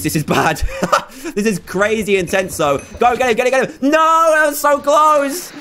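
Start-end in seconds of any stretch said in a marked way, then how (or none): 3.0-5.5 frantic cheering. Real, not acted. Spectator urging a teammate to attack, frantic high shouts. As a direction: urge them on, then wail at the miss.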